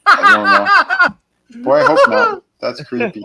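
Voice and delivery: squealing through laughter; high laughing disbelief. Real, not acted.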